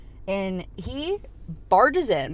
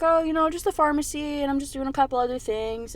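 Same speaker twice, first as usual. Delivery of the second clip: lightly acted higher-pitched innocence; mock-innocent phone voice; breezy cover story; 6 semitones higher than usual. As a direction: play it casual, pitched up. Retold casual cover story on phone.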